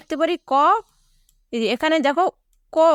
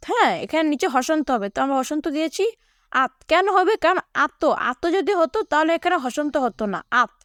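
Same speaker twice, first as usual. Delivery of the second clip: normal talking voice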